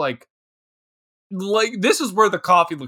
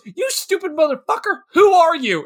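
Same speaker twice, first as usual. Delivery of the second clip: mock angry yell; 9 semitones higher than usual. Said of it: "she comes in and goes".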